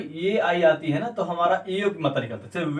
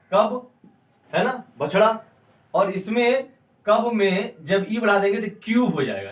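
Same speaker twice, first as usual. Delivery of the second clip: normal talking voice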